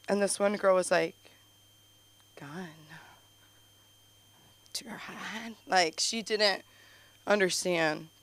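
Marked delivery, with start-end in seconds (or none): 2.4-5.5 hushed, halting disbelief; imitated shocked whisper. Comic imitating a girl who misunderstood the joke, shocked whisper. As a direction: whisper it slowly, with long pauses, as if horrified.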